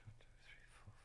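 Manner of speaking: whispered counting; whispering. Acted. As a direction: count under your breath. Counting under breath.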